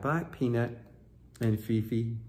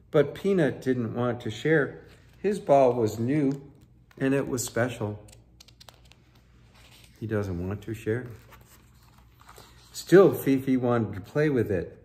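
put-on lively read-aloud for children; story narration; 5 dB louder than usual. A narrator's reading. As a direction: tell it like a bedtime picture book. Reading picture book aloud.

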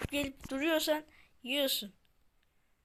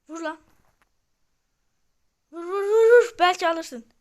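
urging; 4 semitones higher, 7 dB louder than usual. Real, not acted. Urging a teammate on.

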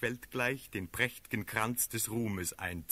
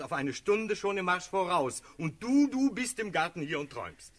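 loud, raised-pitch scolding; indignant reproach; 7 semitones higher, 6 dB louder than usual. Acted. Friend scolding the dreaming cavalry commander, indignant. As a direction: hammer the rebuke home, peaking on the accusation.

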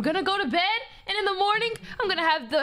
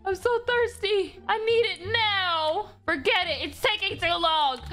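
ordinary delivery

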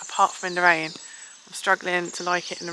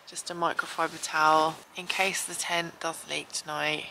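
usual speaking voice

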